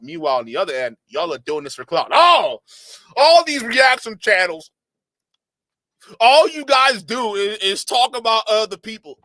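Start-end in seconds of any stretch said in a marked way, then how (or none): 2.1-9.2 loud, high, drawn-out mock whine; mocking complaint. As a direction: parody an indignant critic at high volume. Mimics critics of reaction channels.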